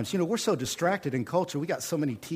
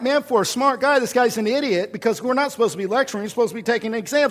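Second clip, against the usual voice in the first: mock indignant thought; 9 semitones higher, 6 dB louder, faster than usual. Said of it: "And I'm like".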